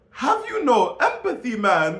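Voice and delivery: high, loud put-on Jamaican accent; mock Jamaican accent. Mocking a politician's random accent, hammed-up Jamaican. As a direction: exaggerate a Jamaican lilt, pitched right up. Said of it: "like, a Jamaican accent".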